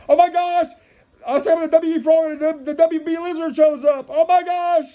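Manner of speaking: high-pitched, hammed-up amazement; excited astonishment. Host expected the frog but the lizard appears, high mock shock. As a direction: blurt it out amazed.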